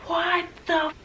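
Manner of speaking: high, stretched-out yell of a name; mock chef scream. The chef yelling Amanda's name across the kitchen. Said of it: "she's screaming".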